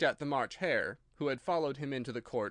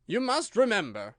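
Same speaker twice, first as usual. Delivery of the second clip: higher, louder stern King; a king scolding his subject; loud reproving royal voice; 6 semitones higher, 7 dB louder than usual. A narrator's reading.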